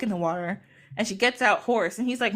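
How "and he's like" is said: ordinary talk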